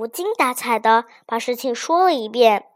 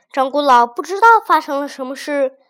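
plain reading voice

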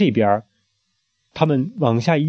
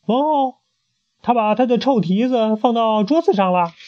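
much higher, whiny tattle; tattling sheep; 10 semitones higher than usual. Acted. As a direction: tell on him eagerly. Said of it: "立刻向王后报告".

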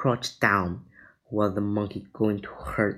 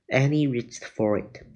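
ordinary delivery

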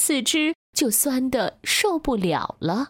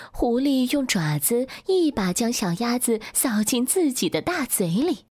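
plain reading voice

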